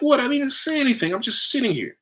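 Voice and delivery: hammed-up I-did-nothing protest; mock indignant surprise. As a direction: play innocent and baffled. Indignant denial after accusation.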